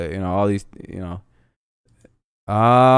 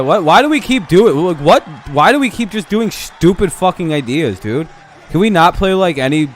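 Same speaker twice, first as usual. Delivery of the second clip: exasperated rant; 6 semitones higher than usual. Real, not acted. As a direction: vent at the dumb baserunning, pitched up. Ranting at his team's mistakes.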